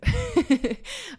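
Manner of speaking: high burst of giggling laughter; bright amused laugh; real laugh. Real, not acted. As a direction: giggle at your own joke. Laughs at her own fun.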